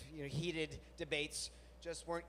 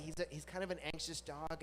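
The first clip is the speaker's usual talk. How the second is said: ordinary delivery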